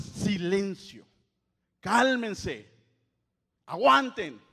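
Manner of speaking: loud slow command to the waves. Acted. Jesus commanding the waves, loud slow order. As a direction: order the sea to be still.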